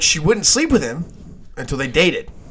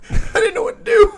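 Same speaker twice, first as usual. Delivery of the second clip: high laugh breaking the words; 4 dB louder than usual. Retold or imitated. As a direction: laugh through the line. Quote broken by laughter.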